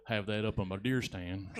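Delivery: mock Southern accent. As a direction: play a Southern deer hunter. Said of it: "Somebody's southern husband is here".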